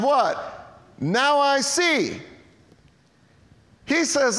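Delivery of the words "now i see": slow, high, drawn-out reveal; dramatic reveal. Acted. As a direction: savor each word of the reveal. Healed man's climactic line.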